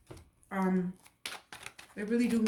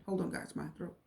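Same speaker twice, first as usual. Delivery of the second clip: real choked-up hush, much softer; choking up; 4 semitones lower, 10 dB quieter than usual. Real, not acted. Tarot reader to viewers, choked up and much softer. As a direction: voice catching in the throat. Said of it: "Choking up a lot during this".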